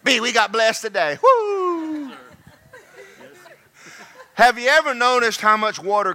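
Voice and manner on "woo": joyful whoop. Real, not acted. Celebrating being blessed.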